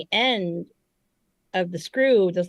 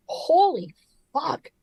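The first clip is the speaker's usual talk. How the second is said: awed gasp; 9 semitones higher, 3 dB louder, much slower than usual. Retold or imitated. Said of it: "it was like".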